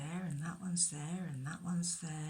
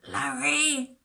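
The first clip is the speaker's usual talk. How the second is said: loud, higher call across the hills; 7 semitones higher, 8 dB louder than usual. Acted. Shepherd searching the hillside, calling loudly for his lost sheep. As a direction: shout the name to find him. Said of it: "called out".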